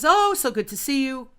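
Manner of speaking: mock gushing greeting. Former classmate greeting her at the rink, mildly mock-gushing. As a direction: imitate an effusive acquaintance.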